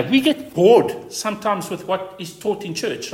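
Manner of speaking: ordinary talk, nothing marked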